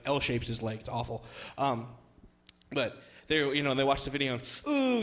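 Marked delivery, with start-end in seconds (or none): 4.7-5.0 high-pitched, louder mock wince; wincing "ooh". Wife and daughter watch the leg break, wincing. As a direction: imitate a sympathetic cringe at an injury. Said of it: "they make the face".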